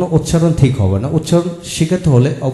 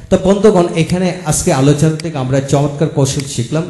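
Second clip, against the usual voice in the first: ordinary talk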